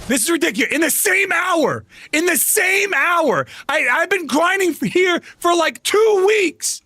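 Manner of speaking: high, exasperated shouting; indignant gamer rage; angry rant. Real, not acted. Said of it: "I'm angry".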